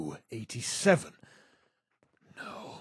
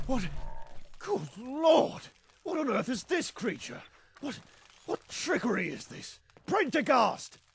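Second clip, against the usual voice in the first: shocked outburst; 13 semitones higher than usual. Acted. Shocked character sees creature.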